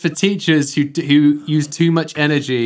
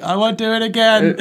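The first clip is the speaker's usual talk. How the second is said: laughing pleading; 9 semitones higher than usual. Pretend. Scared pupil in principal's office.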